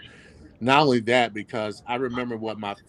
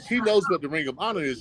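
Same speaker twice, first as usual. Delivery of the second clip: ordinary delivery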